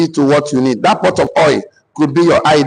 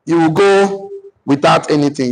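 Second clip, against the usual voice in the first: normal talking voice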